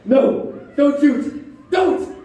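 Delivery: loud, high, panicked begging; terrified plea. Acted. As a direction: beg for your life. Ben Gunn begs not to be shot.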